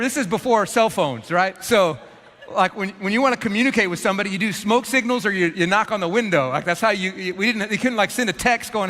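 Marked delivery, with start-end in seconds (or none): none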